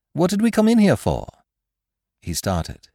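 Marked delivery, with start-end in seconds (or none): 0.1-1.3 high-pitched impatient question; impatient demand. Acted. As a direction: demand an answer, voice shooting up. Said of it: "I demanded impatiently".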